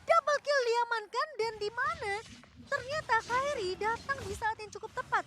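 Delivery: loud, hyped play-by-play call; fighting game announcer. Acted. Live esports play-by-play. (komentator pertandingan yang heboh)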